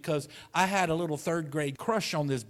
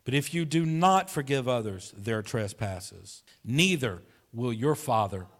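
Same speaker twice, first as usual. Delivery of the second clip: louder, measured Bible reading; scripture reading; 4 dB louder than usual. Acted. Pastor reads Matthew six to the congregation, declaiming. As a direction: proclaim the verse to the church.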